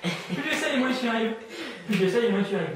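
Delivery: loud, high, hammered hypnotist chant; insistent suggestion. Real, not acted. (suggestion martelée d'une voix forte)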